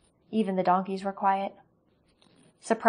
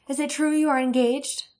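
high, eager voice; eager question; 6 semitones higher, 5 dB louder than usual. Acted. Elaine asking Katrina about the engagement, high and eager. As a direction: ask with breathless delight.

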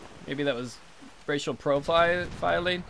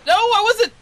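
sudden high-pitched yelp, much louder; high shriek; 11 dB louder than usual. Real, not acted. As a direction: shriek it out in a panic.

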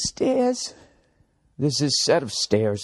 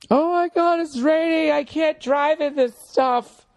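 much higher, squealing panic about rain; mock panicky Angeleno. Mocking Los Angeles drivers, high panicky whine. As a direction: play a fussy local scared of drizzle. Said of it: "Everybody always freaks out".

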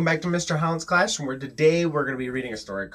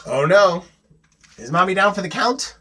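raised, louder mock alarm; suspenseful narration; 6 semitones higher, 7 dB louder than usual. A narrator's reading. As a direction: build suspense with rising questions.

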